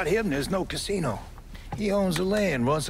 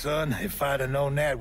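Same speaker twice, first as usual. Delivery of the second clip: normal talking voice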